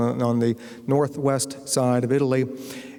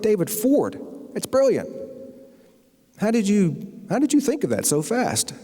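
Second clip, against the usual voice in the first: much higher, gushing with delight; excited agent; 7 semitones higher than usual. Retold or imitated. Agent calls back overjoyed after the publishers approve the name: high, gushing excitement. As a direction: rave like the agent can't believe it.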